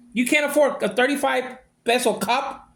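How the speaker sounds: ordinary delivery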